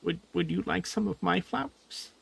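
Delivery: high, gentle little voice; high little creature voice; tiny creature voice. Acted. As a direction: chirp it like a foot-tall friend. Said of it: "he says".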